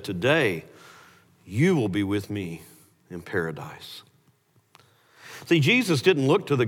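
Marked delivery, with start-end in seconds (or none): 0.0-4.0 much lower and softer, gentle reassurance; tender promise. Retold or imitated. Jesus answering the dying criminal, low soft tender reply. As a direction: hush it low and kind, like a promise.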